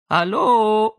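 put-on high, loud girlish hallo; bright high voice. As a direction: sing out a light girlish hello. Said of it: "Wieder die helle Stimme".

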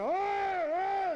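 high, loud cries of pain; pained yelp. Acted. Patient yelping at the hot coffee enema, high and loud. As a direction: yelp as if scalded.

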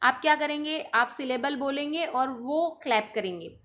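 usual speaking voice

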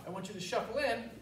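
ordinary delivery

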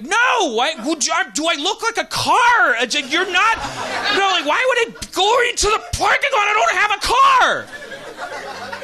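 high, shouted comic rant; exasperated outburst. Retold or imitated. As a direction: protest at full pitch, drawing out the end. Exasperated comeback in acted quarrel.

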